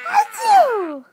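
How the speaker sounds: sudden loud, very high hammed-up achoo; playful mock sneeze; fake sneeze. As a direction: burst out a pretend sneeze for the baby. Parent fakes sneezes for baby.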